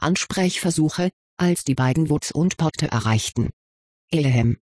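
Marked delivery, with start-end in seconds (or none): 4.1-4.6 throat clearing. Acted. Throat clearing to get attention.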